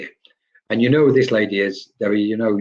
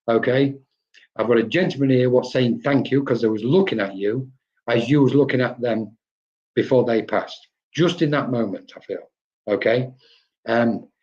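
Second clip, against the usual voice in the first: ordinary talk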